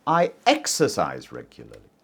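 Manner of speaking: "exercise" in "I exercise regularly" given the weight; stress on exercise. Shown as a demonstration. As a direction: lean on "exercise", not other habits. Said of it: "Not just eating healthily".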